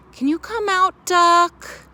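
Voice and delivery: high loud call; calling to duck. Acted. Animals calling into the doghouse, high loud call.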